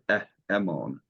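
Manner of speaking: "emon" starting on a bare EH; no H sound. Shown as a demonstration. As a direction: say "emon" as eh-MOAN, without an H. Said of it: "If it's not".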